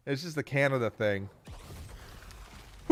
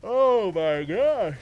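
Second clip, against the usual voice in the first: drawn-out exclaim; 5 semitones higher, 6 dB louder, much slower than usual. Real, not acted. Overwhelmed by chat list.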